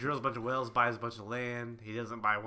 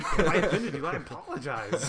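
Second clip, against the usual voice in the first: mock apology; 5 semitones higher, 10 dB louder than usual. Acts out the menacing apology.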